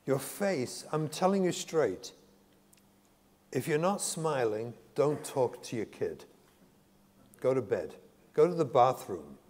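ordinary talk

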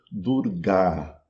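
"Durga" with a long, open final A; Sanskrit long A. Shown as a demonstration. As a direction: hold the last A of "Durga". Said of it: "termina com A longo". ("Durga" com A final longo)

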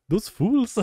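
high mock Viking accent; Nordic accent. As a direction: sneer it like a Viking. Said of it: "I was trying to do, like, no, like, Nordic".

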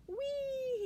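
long, high squeal of glee; gleeful whee. Acted. Octopus racing through water, high gleeful whee. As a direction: let it fly up high.

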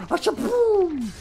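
high, loud playful boom noise; explosion sound effect. Pretend. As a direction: belt out a cartoon explosion.

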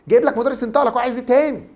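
usual speaking voice

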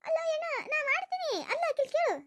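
high, drawn-out protest; defensive retort. Acted. Woman defending herself to her son, high protest.

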